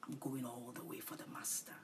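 soft whisper, to yourself; whispered vow. Shown as a demonstration. Preacher modelling a whispered vow for listeners. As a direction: murmur it privately as a vow. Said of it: "Say to yourself".

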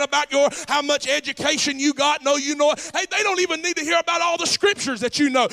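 high, loud sung preaching cadence; preaching at full force. Real, not acted. Pastor whooping to the church about Jesus as bait. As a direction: whoop it up, building to the climax.

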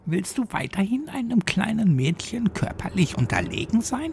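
hohe Mädchenstimme, spöttisch fragend; höhnisches Mädchen. A narrator's reading. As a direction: sie genüsslich bloßstellen. Lucy verhöhnt Carina. (Lucy verspottet Carina, hohe, höhnische Mädchenstimme)

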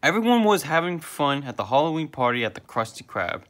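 usual speaking voice

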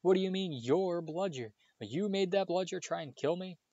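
Harry confronts the elf, angrily; rising anger. A narrator's reading. Angry boy confronting house elf, voice rising. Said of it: "anger rising once more".

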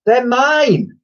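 high, loud, desperate plea; urgent high-pitched protest; pleading. A narrator's reading. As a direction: cry out as a boy robbed. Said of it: "pleaded the boy".

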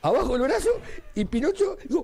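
high voice breaking with laughter; high giggly retelling; laughing while telling. Real, not acted. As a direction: tell it while cracking up. Laughing through the punchline.

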